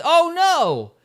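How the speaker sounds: high, loud "oh no" yelp; dismayed cry. Retold or imitated. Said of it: "And Jess says".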